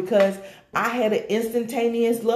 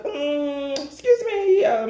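mock indignant mumble; 5 semitones higher, slower than usual. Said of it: "mumbling to myself".

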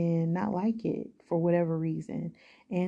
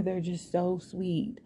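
ordinary talk, nothing marked

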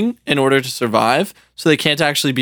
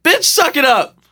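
mock scolding; 11 semitones higher than usual. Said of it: "I'd be like".

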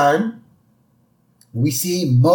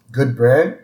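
ordinary delivery

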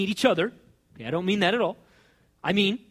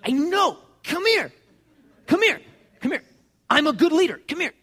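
high, loud, eager reassurance; insistent beckoning; 9 semitones higher, 5 dB louder than usual. Acted. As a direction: wave away excuses and keep calling them over. Jesus interrupts excuses, beckoning.

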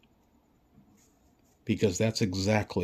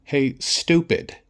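mocking government voice; 4 semitones higher, 5 dB louder than usual. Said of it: "They're basically saying".

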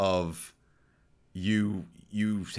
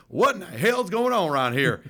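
higher-pitched mock Southern accent; hammed-up Arkansas accent; put-on Southern accent; 7 semitones higher, 4 dB louder than usual. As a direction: exaggerate a baffled Southern accent. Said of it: "he had a Southern Arkansas accent".

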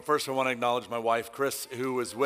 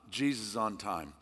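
softer, slow repeat; a hushed second say of the title; quiet echo of title; 6 semitones lower, 8 dB quieter than usual. Real, not acted. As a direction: let it sink in softly. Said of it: "the title of this is".

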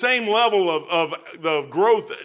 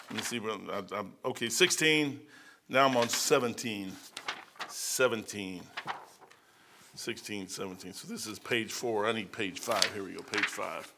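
muttering to himself; 8 semitones lower, 8 dB quieter than usual. Real, not acted. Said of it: "looking for his page".

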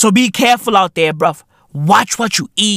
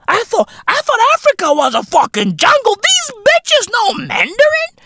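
much higher squeaky mock voice, gasping in disbelief; mock delegates gushing. Delegates marvelling at the women, in a high mock squeal. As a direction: squeal it like a giddy gossip, ham it up. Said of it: "just fucking giggling like".

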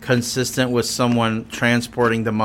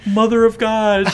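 shocked exclamation; 8 semitones higher than usual. Real, not acted. Exclaiming at gross story.

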